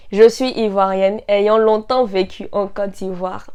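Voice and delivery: ordinary talk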